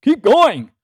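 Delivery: put-on high, loud German-accented radio order; Tom. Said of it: "he does have a German accent".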